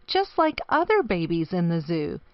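usual speaking voice

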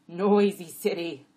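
loud, drawn-out donkey voice; chiming-in donkey. A narrator's reading. Second jack adding his complaint, loud and slow. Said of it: "Abbott chimed in".

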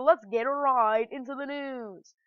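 drawn-out mock news-show intro; slow, long-held final word; Keemstar catchphrase. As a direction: stretch it out like a hyped show opener. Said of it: "I am stealing Keemstar's phrase".

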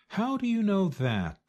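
high, eager fox question; fox asking. A narrator's reading. Fox questions Chicken Little.

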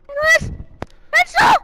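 high, loud excited yelling; excited shout. Real, not acted. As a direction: cheer it out loud. Excited in game.

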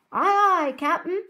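loud, high obedient shout; eager pirate crew. Acted. Pirates answer their captain's order, calling out smartly. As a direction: sing out the reply like a deckhand.

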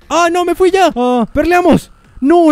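sudden high panicked yells; panicked shout. Real, not acted. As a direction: cry out in alarm as you die. Dies suddenly in game.

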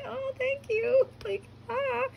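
very high, drawn-out teary squeal; overwhelmed fangirl. Retold or imitated. Fan thanking the actress for praise, overwhelmed squeal. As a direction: squeak out thanks, nearly crying with joy.

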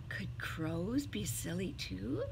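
plain reading voice

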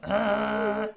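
loud, high held "ah"; high vocal demo. Shown as a demonstration. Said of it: "we need to think of it higher".

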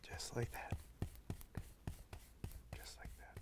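soft breathy painting instructor whisper; Bob Ross whisper. Retold or imitated. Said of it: "that's a Bob Ross sound".

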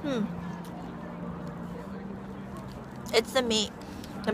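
talking with mouth full; chewing. Real, not acted. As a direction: between bites of food. Seen 0: Bites hot dog at -5, chews with closed lips until about 4.3.

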